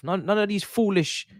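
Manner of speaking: ordinary talk, nothing marked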